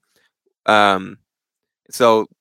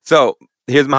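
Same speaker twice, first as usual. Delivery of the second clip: usual speaking voice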